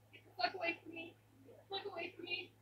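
fast hushed scared pleading; tense whisper. Real, not acted.

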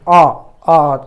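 अ, अ as isolated open "aw" sounds; short O. Shown as a demonstration. Teacher repeating the vowel for students, अ, अ loud and separate. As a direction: punch each अ separately, open "aw". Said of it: "अ उच्चार करतोय".

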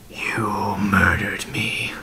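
slow, breathy whisper; drawn-out whisper from a dead man; whispered accusation. Acted. Dead man confronting his killer, slow whispered accusation.

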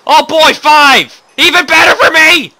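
high loud mock delight; sarcastic cheer. Real, not acted. Gamer mocking his lost levels, loud sarcastic cheer. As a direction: fake joy dripping with sarcasm.

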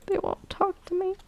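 very high, small pouty voice; high-pitched playful whine; mock hurt. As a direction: squeak it like a snubbed little kid. Complains that game cats ignore her.

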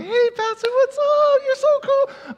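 squeaky put-on voice of fake church friends; mock gossipers' greeting. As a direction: mimic the two-faced flatterers sweetly. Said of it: "they're talking".

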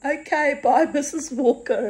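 high, chirpy child voice, imitated; a cheeky kid scampering off; little girl voice. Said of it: "I'll be".

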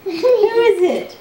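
very high, slow teasing question; high playful coo. Acted. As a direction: coax the toddler in a high voice. Playful voice for toddler.

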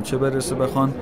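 ordinary talk, nothing marked

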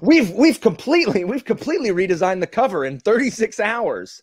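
high, loud, hammed-up big reveal; mock makeover-show host. Host presenting a rescued book, overblown excitement. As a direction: proclaim it like a breathless TV announcement.

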